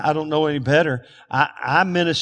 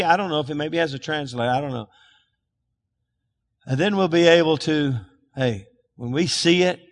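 usual speaking voice

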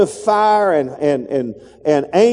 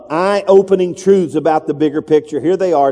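ordinary talk, nothing marked

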